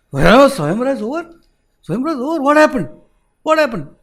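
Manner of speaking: can't believe what he hears; shocked Kali. Acted.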